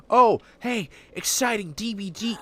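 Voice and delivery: high, loud, hammed-up hype voice; mock an overexcited video intro. Mocking a hyped viewer-facing intro.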